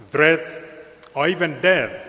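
ordinary delivery

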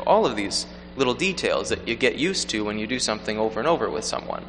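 usual speaking voice

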